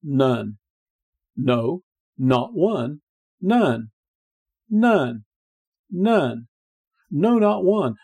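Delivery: "None" and "No, not one" said as separate, weighty statements; emphatic repetition. Shown as a demonstration. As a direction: hammer each "None" on its own, with a pause after it. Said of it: "Note the emphasis".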